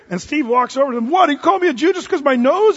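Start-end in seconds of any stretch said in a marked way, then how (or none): 1.1-2.8 loud, high, affronted comeback; a tough ex-soldier squaring up to an insult; indignant challenge. Retold or imitated. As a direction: fire the question back at him, offended. Steve confronts the heckler angrily.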